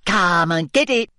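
high, teasing, giggly taunt; malicious giggling mermaid. Acted. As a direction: taunt him playfully. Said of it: "she giggled maliciously".

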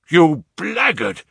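loud, high and drawn out; enraged shout. A narrator's reading. Father finds beryls missing from the coronet, enraged shout. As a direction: shout the insult, beside yourself with rage. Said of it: "I shouted, beside myself with rage".

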